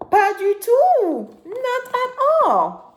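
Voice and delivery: high, slow, stressed denial; emphatic slow no. Acted. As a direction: stretch out the no. (non appuyé et lent)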